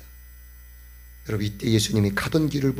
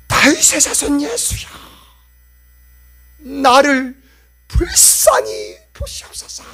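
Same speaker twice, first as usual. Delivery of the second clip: crying out; 12 semitones higher, 8 dB louder than usual. Acted. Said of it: "라고 해서 소리칩니다".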